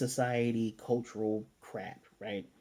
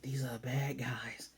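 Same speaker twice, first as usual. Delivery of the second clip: slow, hushed whisper; imitating a child's whispered aside; acted whisper; 6 dB quieter, slower than usual. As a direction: whisper it like a secret realization. Anya's secret aside, acted.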